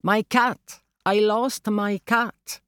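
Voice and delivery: high-pitched, drawn-out narrated cry. A narrator's reading. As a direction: call it out slowly so foreigners understand. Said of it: "Alors je reprends, plus fort".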